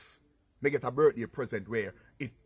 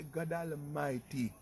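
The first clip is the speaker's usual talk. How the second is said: slow, hushed "God Almighty"; retold dismay; 8 dB quieter, slower than usual. His hushed reaction to sentence.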